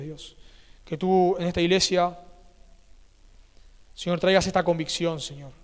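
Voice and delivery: fervent prayer. Acted. Pastor praying aloud for his congregation, fervent and pleading. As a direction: pray out loud over the church, pleading.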